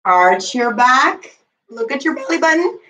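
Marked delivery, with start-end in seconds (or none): 0.1-1.4 slow cue. Shown as a demonstration.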